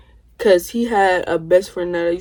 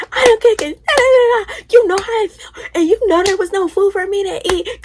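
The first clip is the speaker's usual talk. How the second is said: high shrieking mock yell, much higher and louder; imitated screaming; 12 semitones higher, 3 dB louder than usual. Cousin screaming at the door about having no food, mock shriek. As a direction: shriek it back like she did. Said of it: "she's screaming".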